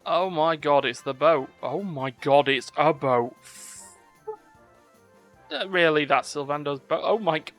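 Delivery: loud, astonished exclamations; excited amazement. Real, not acted. Player reacting as Sylvando's boat appears, excited amazement.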